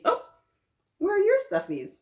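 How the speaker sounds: high, loud mock gasp of surprise. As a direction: act shocked the kids have no stuffies. Mock surprise at missing stuffies.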